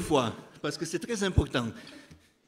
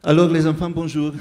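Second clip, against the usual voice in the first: calling out; 8 dB louder than usual. Real, not acted. Animateur lançant un bonjour sonore aux enfants. Greeting children loudly from stage.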